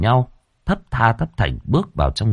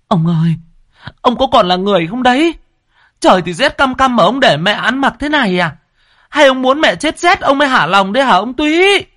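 high, loud, tearful scolding; crying and scolding; 5 dB louder than usual. A narrator's reading. Wife scolds her husband while crying, high and loud. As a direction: scold him through tears. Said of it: "vừa khóc vừa mắng".

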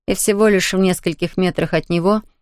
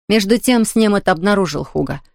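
ordinary delivery

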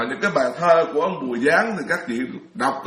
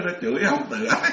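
higher, amused retelling breaking into laughter; laughing quote; 6 semitones higher than usual. Retold or imitated. Monk retelling his colleagues' jibe about himself, laughing.

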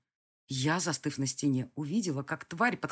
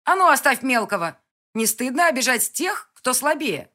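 loud, higher angry yell; shouting at the beast; 8 semitones higher, 11 dB louder than usual. Acted. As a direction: bellow it down from the wall. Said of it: "закричала я". (громкий окрик)

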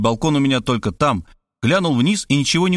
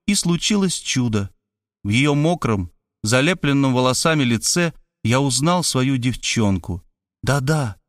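usual speaking voice

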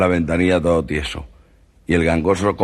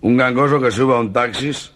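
ordinary talk, nothing marked

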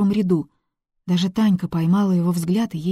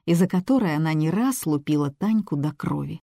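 ordinary delivery

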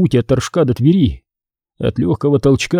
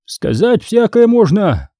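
slow, higher, noncommittal hedge; evasive reply; 8 semitones higher than usual. A narrator's reading. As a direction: dodge the question without committing. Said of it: "уклончиво отвечал десятник". (уклончиво, неспешно)

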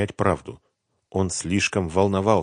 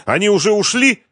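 high, loud nervous question; jittery, worried asking; anxious; 9 dB louder than usual. A narrator's reading. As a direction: ask in an alarmed high voice. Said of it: "тревожно осведомился он".

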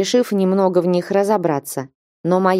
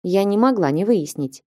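usual speaking voice

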